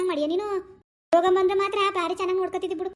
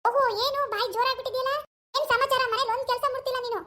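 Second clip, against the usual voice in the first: put-on a higher, nagging young wife; daughter-in-law role; 6 semitones higher than usual. Actor voices daughter-in-law scolding husband about mother. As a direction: complain sharply to husband about his mother.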